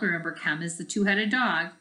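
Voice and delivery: plain reading voice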